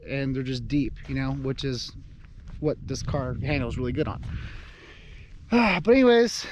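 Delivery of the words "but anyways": excited. Real, not acted. Excited sign-off to viewers.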